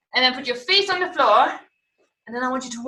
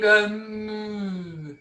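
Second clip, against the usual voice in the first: long, low moo; low drawn-out cow sound; happy cow; 5 semitones lower, 4 dB quieter than usual. Shown as a demonstration. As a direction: moo slowly like a contented cow. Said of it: "be a happy cow".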